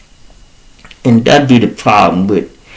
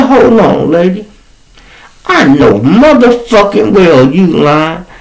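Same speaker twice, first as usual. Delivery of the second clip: retold angry comeback, pitched up; snapping; 7 semitones higher, 3 dB louder than usual. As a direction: cut her off with indignant force. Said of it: "I snapped, y'all".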